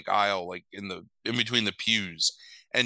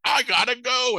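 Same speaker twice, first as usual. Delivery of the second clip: high, louder, hurried-sounding blurt; hammed-up panic, pitched way up; mock panicked exit; 12 semitones higher, 5 dB louder than usual. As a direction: blurt it like someone about to bolt. She bolts, retold.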